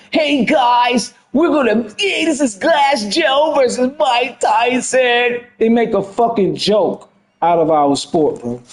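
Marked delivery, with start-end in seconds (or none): 0.1-5.4 slow, higher mock YouTuber voice; mock hype streamer. Mocking the game maker's video intro, slow and higher. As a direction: ham up a video intro, drawn out.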